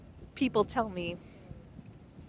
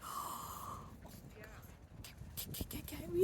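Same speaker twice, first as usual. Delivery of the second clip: awed hush; 7 semitones higher, 10 dB quieter than usual. Retold or imitated. Passers-by awed by the dog.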